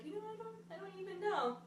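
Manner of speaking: high, soft imitation of a puzzled friend; imitating her friend. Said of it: "and she was like".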